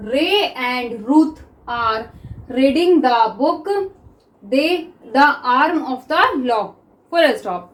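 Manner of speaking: very slow, word by word with pauses; slow dictation. Shown as a demonstration. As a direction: dictate each word so students can write. Teacher dictates sentence slowly.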